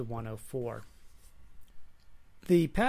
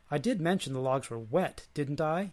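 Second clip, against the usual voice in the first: plain reading voice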